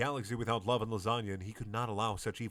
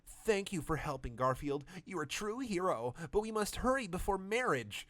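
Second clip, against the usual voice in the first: higher urgent woman's voice; higher hammed-up Miranda; hurried Miranda; 8 semitones higher than usual. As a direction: hurry them along. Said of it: "Miranda said with rushing words".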